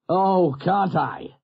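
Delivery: slow, high, gleeful; amused retort. Acted. Doctor teasing captain, slow and high gleeful. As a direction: drag it out with glee. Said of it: "guffawed in sudden recollection".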